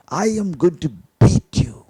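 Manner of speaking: a hint of a harsh parent yelling; harsh threat. Shown as a demonstration. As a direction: threaten the child, lightly mock-harsh. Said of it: "you can yell at them and make it very hard".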